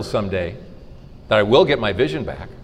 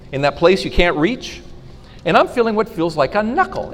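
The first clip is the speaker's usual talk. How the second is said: normal talking voice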